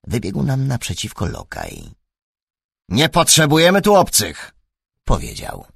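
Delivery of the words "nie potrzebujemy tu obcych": a louder, higher footman turning strangers away; gruff footman. A narrator's reading. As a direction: bark it like a servant guarding the gate. Lokaj przegania obcych. (lokaj ostro przegania obcych, głośniej)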